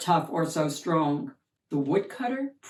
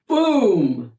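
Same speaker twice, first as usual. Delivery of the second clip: loud boom; 8 dB louder than usual. A narrator's reading. Thunderous sound in story.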